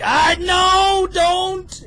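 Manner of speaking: loud high panicked cry; panicked yell. Retold or imitated. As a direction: yell like it's going wrong. His panicked reaction, quoted.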